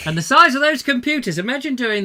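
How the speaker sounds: big wide-eyed exclamation; excited exclaim. Real, not acted. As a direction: marvel out loud at the size.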